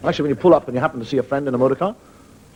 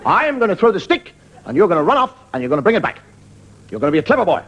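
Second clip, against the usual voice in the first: dog owner commands; 3 semitones higher than usual. Retold or imitated. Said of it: "You see him walk with the dogs".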